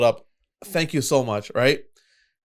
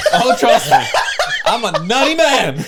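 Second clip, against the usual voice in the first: louder, higher, laughing through it; giggling playful brag; laughing mock boast; 8 semitones higher, 7 dB louder than usual. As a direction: ham it up while cracking up. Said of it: "plays up the nutty line".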